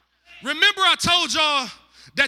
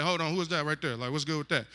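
hushed, lower mock reaction; low quiet aside; 10 semitones lower, 9 dB quieter, faster than usual. Man noticing a woman across the field, hushed low aside. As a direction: drop the voice low and quiet like a guy spotting a girl.

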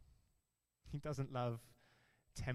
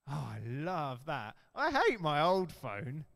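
fickle phone lover; 6 dB louder than usual. Retold or imitated. Preacher mocking how people love gadgets, hammed-up gushing. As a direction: play a fickle buyer swooning over a new phone. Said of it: "we see a different phone".

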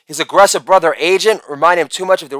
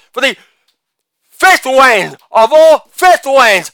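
ordinary delivery

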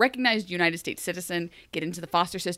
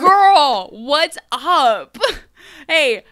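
higher, loud, bubbly bestie voice; hammed-up casual Emma; 4 semitones higher, 8 dB louder than usual. Emma cheerily greeting the woman who almost killed her, loud and bubbly. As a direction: gush like meeting an old friend.

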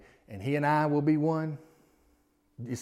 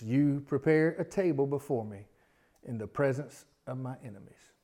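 very slow, pausing scripture reading. Shown as a demonstration. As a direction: read each phrase slowly with pauses. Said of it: "I wanna read 'em kinda slow".

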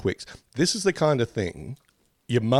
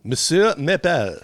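put-on French accent; 6 semitones higher, 3 dB louder, slower than usual. Mock French mascot name.

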